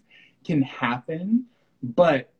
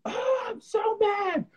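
very high, slow, teary whine; whiny tantrum. Retold or imitated. Her old self after stubbing a toe, a whiny mock sob. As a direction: ham up a crybaby fit. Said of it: "I would just be like".

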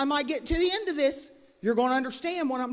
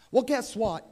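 ordinary delivery

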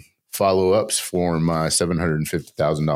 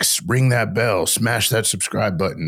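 slow, hammed-up youtuber outro; lightly sending up other creators' begging; a touch of a hyped streamer, slowed; mock youtuber plea; 5 semitones higher, slower than usual. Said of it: "They're like".

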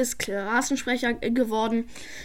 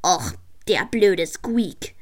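lower, slow annoyed grumble; 5 semitones lower, slower than usual. Acted. Karl sulking after Squeak wins, mild annoyed grumble. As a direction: grumble it like a sore loser. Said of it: "sagte Karl verärgert".

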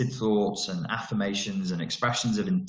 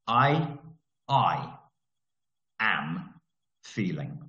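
word by word with long pauses, dropping lower; slow dictated template; 4 semitones lower, much slower than usual. Shown as a demonstration. Coach dictating a basic affirmation to listeners, slowly word by word. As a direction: spell it out one word at a time, slow and low. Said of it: "this is how I do it".

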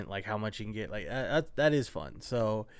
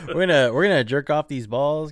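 loud, higher, through laughter; laughing; 4 semitones higher, 8 dB louder than usual. Real, not acted. Host riffs crudely to co-host on juiced balls, laughing. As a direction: crack up while delivering the joke. Cracking up at own crude joke.

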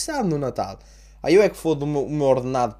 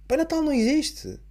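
much higher, blunt verdict that Santa isn't real; exasperated dad; 12 semitones higher than usual. Pretend. Father breaking the Santa news to his son, high exasperated blurt. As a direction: drop the news on the kid, fed up. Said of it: "ter um filho e ter que lhe fazer isto".

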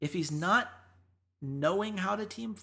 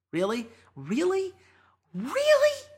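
each "Really?" climbing higher and louder; mounting disbelief; 11 semitones higher, 6 dB louder than usual. Acted. Scolding Forgiven for trash talk, mounting disbelief. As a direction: escalate from doubt to a very high, incredulous squeal.

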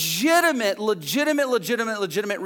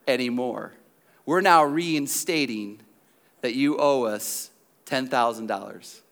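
grave, measured, sinking lower to the end; mock-grave verdict; 5 semitones lower than usual. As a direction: deliver an ominous letter with long pauses. Stern letter reinstating the debt.

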